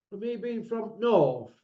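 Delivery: ordinary talk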